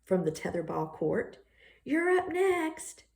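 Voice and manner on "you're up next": calling out. Acted. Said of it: "Emilio calls".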